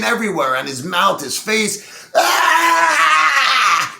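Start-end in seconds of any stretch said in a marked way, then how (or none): 2.1-4.0 loud high-pitched scream; high shriek. Acted. Client shrieking as she pees on him. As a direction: shriek in horror.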